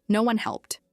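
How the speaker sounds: ordinary delivery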